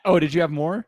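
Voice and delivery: normal talking voice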